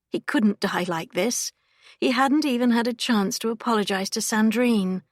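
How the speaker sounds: ordinary delivery, nothing marked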